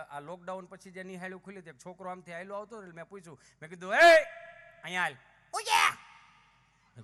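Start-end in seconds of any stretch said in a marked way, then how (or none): 3.9-5.1 loud shout across the yard; calling out. Retold or imitated. Teacher shouting to a boy to come over, loud call. 5.5-7.0 far-off shouted answer, drawn out; shouted reply. Retold or imitated. Boy answering the teacher's call, slow shout.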